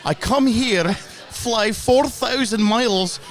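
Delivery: hammed-up Scottish accent with rolled R's. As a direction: play a proud Glasgow fan in a put-on Scottish accent. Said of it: "the trill of the R's is a very nice touch".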